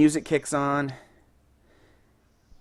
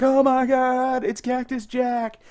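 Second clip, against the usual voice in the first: much higher, slow, awestruck; crowd going wild; 10 semitones higher, slower than usual. Retold or imitated. Recreating the crowd as Cactus Jack appears, awestruck and much higher. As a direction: gasp it out like a stunned fan in the arena. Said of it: "Everyone's like".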